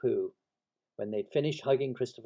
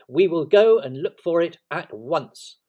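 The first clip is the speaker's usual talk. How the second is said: loud, higher, slow determined call; 6 semitones higher, 13 dB louder than usual. A narrator's reading. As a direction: call out with firm resolve. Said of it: "he called out to Eeyore".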